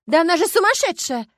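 high, loud, furious accusation; outraged shout. A narrator's reading. Starkova lunging as Anfisa speaks, outraged shout. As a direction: explode in protest. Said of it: "рванулась Старкова".

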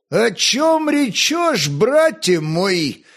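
high, drawn-out exclamation; slow, high-pitched cry of protest; exclaiming. A narrator's reading. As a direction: exclaim it slowly, pitched well up. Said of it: "воскликнул крючконосый Волхв".

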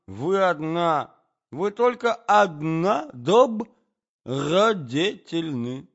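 high, loud, halting speech; slow, befuddled gentleman declaiming; effusive prince. A narrator's reading. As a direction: bid farewell grandly, drawing out each phrase.

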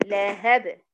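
"لهب" as lahab with bounced b; stopped form. Shown as a demonstration. Teacher repeating the pause form, "لهب" stopped on b. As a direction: close "لهب" on an echoed b, no vowel. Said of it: "الحرف الأخير فهتبقى إيه".

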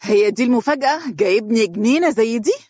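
voiced as a cross girlfriend; indignant. A narrator's reading. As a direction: challenge him, miffed and incredulous. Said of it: "بحنق قائلة".

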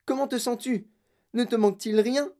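high-pitched worried questions; high, fretting mother; anxious crying out. Acted. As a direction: ask urgently, pitch well up. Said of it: "cria madame Tivy".

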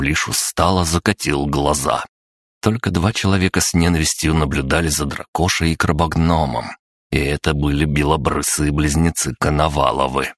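plain reading voice

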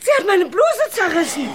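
high, laughing outrage; half laugh, half shriek about the blouse; hysterical laughing cry. Acted. Torn blouse in a scuffle, laughing hysterically.

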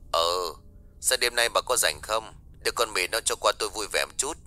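soft, distant male caller on the line; muted telephone voice, casual request; voice on phone. Acted. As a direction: speak as the man at the other end of a call. Man heard on the phone.

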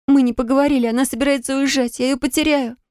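high, urgent, close to sobbing; tearful pleading. Acted. Heroine begs the healer to send her back, tearful. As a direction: beg through tears for help. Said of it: "Я всхлипнула".

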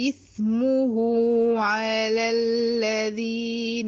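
slow chanted tajweed recitation; Quran recitation. Shown as a demonstration. Recites next verse. (تلاوة مجودة بطيئة)

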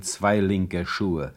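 slow, weighted mockery; drawn-out dry joke; deadpan slow. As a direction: savour each word. Mocking bequest.

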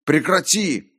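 high, loud cry to stop; shouting. A narrator's reading. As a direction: cut the tormentor off with a shout. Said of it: "крикнул я".

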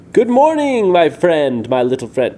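booming sing-out greeting, starting high and dropping; big hello in a put-on giant accent; giant's changed accent. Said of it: "The giant's accent had changed".

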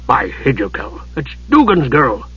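lightly growled ruffian voice; gruff growl. A narrator's reading. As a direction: growl it with mild surprise. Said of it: "the gruff voice growled".

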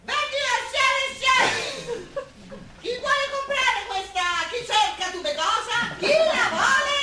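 high, loud market shouting; loud, high, laughing street cry; loud vendor cry. Acted. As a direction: hawk the flower to the whole street. Hawking the flower loudly.

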